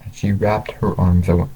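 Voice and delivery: ordinary delivery, nothing marked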